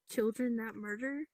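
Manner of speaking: normal talking voice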